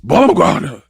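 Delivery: climbing high and loud clown menace; Pennywise impression. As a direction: threaten her in a sneering clown voice. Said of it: "I can't even get the impersonations out good".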